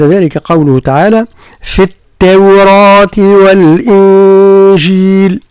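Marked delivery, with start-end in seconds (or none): 1.6-5.4 slow, drawn-out tajweed chant; Quran recitation. Shown as a demonstration. Teacher reciting a verse to his student. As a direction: chant the verse slowly, each letter given its due.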